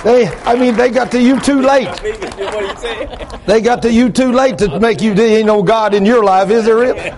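high, loud exultant preaching; carried away with fervor; fired-up preaching. Real, not acted. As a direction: preach it at full force, pitched right up. Preacher worked up before congregation.

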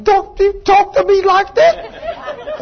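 very high, drawn-out mock wife voice; indignant wife. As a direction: mimic an offended wife in a squeaky-high pitch. Retelling wife's indignant complaint.

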